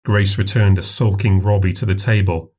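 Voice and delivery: usual speaking voice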